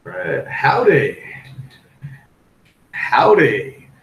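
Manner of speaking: put-on slow Southern-accented howdy. Crypto host greets his live chat in a Southern accent. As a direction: greet them like a Southern good old boy, Southern accent. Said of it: "I could do most of the broadcast in a very southern accent".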